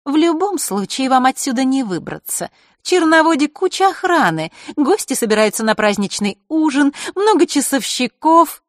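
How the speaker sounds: put-on high, taunting girl's voice; gloating girl. A narrator's reading. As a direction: taunt them about the guards. Маришка gloats.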